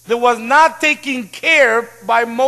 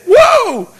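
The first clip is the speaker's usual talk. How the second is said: high, louder whoa of alarm; startled mechanic; 10 semitones higher, 5 dB louder than usual. Retold or imitated. Mechanic sees the car, shocked. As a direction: react aghast, pitch jumping up.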